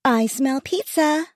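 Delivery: put-on high sing-song girl's call. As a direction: sing it out as a happy girl. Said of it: "Aubrey's sing-song voice called".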